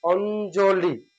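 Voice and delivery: অঞ্জলি said slowly as "ond-jo-li"; teacher stretches অঞ্জলি syllable by syllable; slow model word. Shown as a demonstration. Teacher models a word for students, slow and careful. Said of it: "অঞ্জলি শব্দটা কি negative না positive".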